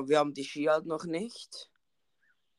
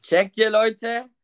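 higher, louder, slow call to viewers; excited call-out; 7 semitones higher, 7 dB louder, slower than usual. Real, not acted. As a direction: call out to the audience. Calling out to viewers.

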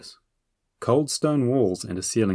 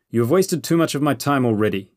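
louder and higher, impatient; more loudly; 5 semitones higher, 5 dB louder than usual. A narrator's reading. Lord loses patience with his prisoners, louder. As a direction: raise the voice, out of patience. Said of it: "the Lord said more loudly".

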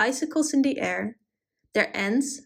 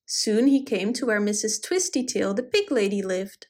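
ordinary delivery

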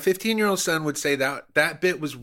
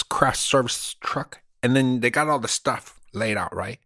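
mock Cuban accent; 3 semitones higher than usual. Comedian bragging to cohost about being inside Hollywood, in a mock Cuban accent. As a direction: play a fake Hollywood Cuban tough guy in a Cuban accent. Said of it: "I was using an accent".

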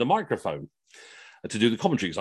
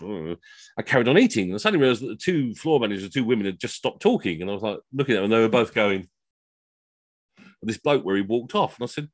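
ordinary delivery

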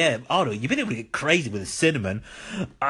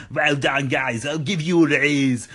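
ordinary talk

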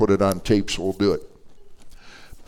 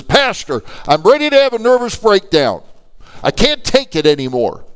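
high, loud, overwrought wail of complaint; frantic plea; 11 semitones higher, 5 dB louder than usual. Retold or imitated. Lady tells pastor she's near a breakdown, frantic. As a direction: act out someone at the end of her rope.

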